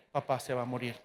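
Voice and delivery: hushed, low fearful inner voice; a son's quiet dread at his father's bedside; inner thought. Retold or imitated. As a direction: say it softly to yourself, dreading it. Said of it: "yo decía dentro de mí".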